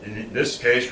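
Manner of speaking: usual speaking voice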